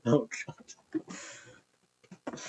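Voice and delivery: whispered oh God; half-laughing whispered dismay; breathless groan. Real, not acted. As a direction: exhale it in mock horror at yourself. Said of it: "I'm not a diddler scout".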